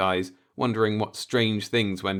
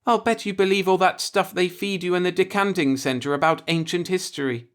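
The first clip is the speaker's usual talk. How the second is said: scornful; 12 semitones higher, 5 dB louder than usual. A narrator's reading. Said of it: "Jeanette laughed scornfully".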